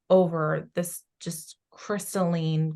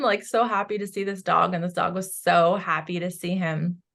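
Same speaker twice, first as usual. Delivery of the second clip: ordinary talk, nothing marked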